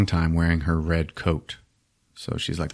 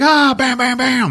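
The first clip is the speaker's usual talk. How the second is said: high, loud yelp into shot noises; hammed-up bang-bang; imitated gunfire; 4 dB louder than usual. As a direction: act out getting shot, cartoonishly. Imitates gunshots and man hit.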